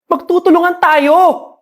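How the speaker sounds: ordinary talk, nothing marked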